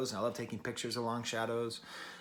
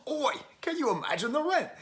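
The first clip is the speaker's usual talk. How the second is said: high-pitched mock-British "Oi"; hammed-up British accent; 12 semitones higher than usual. Joking about how the singer sounds, hammed-up British accent. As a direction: put on a silly, swooping British voice. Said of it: "if that was a British accent, that's how she sounds".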